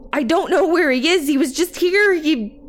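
high-pitched frantic protest; panicked. A narrator's reading. Daycare worker who lost a boy, panicked. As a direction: voice climbing, words tumbling into a break.

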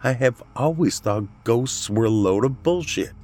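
freaked-out aunt. A narrator's reading. Becca tells her sister about the ghost, freaked out. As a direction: confide your shock to your sister.

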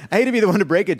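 barely holding back laughter; fast, giggly delivery; laughing. Real, not acted. Retakes the line laughing.

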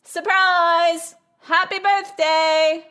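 high-pitched party cheer, drawn out at the end; yelled surprise greeting. A narrator's reading. Room full of guests surprising the birthday couple, yelling high. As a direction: yell it like a crowd springing a surprise. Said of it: "looked up at Barbara and yelled".

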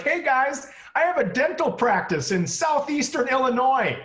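high, nerdy suburban guy; high dentist voice. Retold or imitated. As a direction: chirp it like a dorky dad. Voicing a dentist biker.

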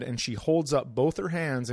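ordinary talk, nothing marked

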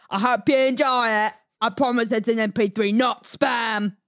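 put-on Australian accent. Reading a listener's message on request, mock Australian accent. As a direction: read it out as a chatty Australian. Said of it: "Can you read his message in Australian accent?".

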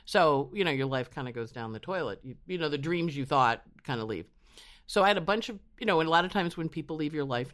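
ordinary talk

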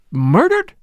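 loud, high, rising disbelief; shocked cry. A narrator's reading. Catherine learning of the murder, shocked cry. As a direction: cry out in startled horror. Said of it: "cried Catherine".

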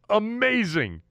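high, excited exclamation of awe; amazed. Real, not acted. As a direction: burst out in real amazement at the play. Marvelling at winning touchdown.

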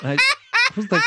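squealing giggle way up high; high shocked laugh. Real, not acted. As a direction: burst into a high incredulous laugh. Reacting to tattoo story.

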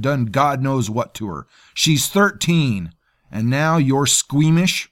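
high, loud ranting tirade, slowing at the end; furious rant. A narrator's reading. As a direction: berate him in fury. Maggott rants at Javin.

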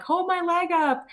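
high strained plea in labor; urgent high cry to husband while in labor; pained demand. Retold or imitated. In labor, ordering husband to hold her leg, high strained plea.

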